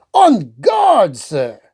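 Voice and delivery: high loud challenge; mock duel challenge. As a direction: call it like a swordsman. Hubert challenges with fish.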